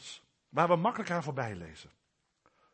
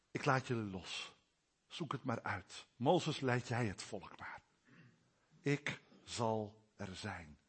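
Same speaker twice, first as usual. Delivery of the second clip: God letting go; 8 semitones lower, 8 dB quieter than usual. Acted. Preacher voices God releasing people.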